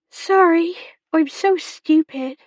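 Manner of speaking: put-on high, upset young girl; girl close to tears. A narrator's reading. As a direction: apologise in a high, distressed girlish voice. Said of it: "chin quivering".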